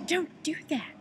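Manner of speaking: high, loud mock scolding; sharp, high-pitched telling-off; retold ranting. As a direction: imitate an appalled stranger snapping at you. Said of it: "ranting and raving".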